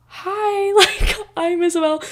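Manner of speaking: high, excited squeaky greeting through a laugh; nervous giggly hi. Retold or imitated. Girl meeting the radio host, high nervous giggle. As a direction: squeal out her own name nervously.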